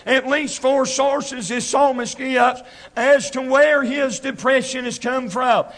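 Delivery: driving, rhythmic revival sermon delivery; country preacher pressing each phrase home; preaching. Real, not acted. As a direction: preach it hard, leaning loudly on key words. Preaches at full force.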